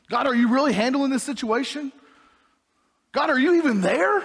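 anguished plea; desperate plea. Pretend. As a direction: cry out to God in desperation. Pleading questions to God.

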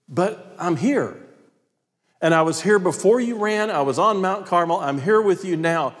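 God. Acted. God confronting the runaway prophet, reproving. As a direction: reason with him firmly, pitch lifted. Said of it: "then God asks him the question".

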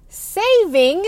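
sarcastic air-quote voice. Real, not acted. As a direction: say it as if in quotes, mocking. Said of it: "in quotation marks".

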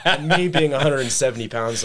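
high, loud laugh carrying into the words; laughing while talking. Real, not acted. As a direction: laugh at the absurd joke. Laughs at his dad's joke.

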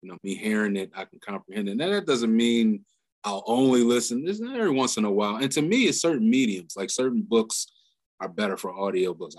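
normal talking voice

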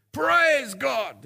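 high, ringing mock shout of praise; acted praise shout. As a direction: call it skyward as the old lady. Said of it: "raise her arms to the sky and shout".

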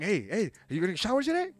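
mocking a roommate's morning cheer; chirpy roommate imitation. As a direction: pester him brightly. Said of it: "And you're like".

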